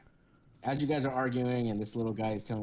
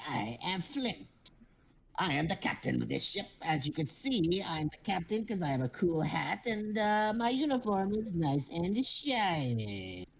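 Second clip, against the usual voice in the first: higher, self-important little captain; pompous kobold captain; 7 semitones higher than usual. Acted. Kobold captain boasting to the crew, high and pompous. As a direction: puff up like a tiny captain in a big hat.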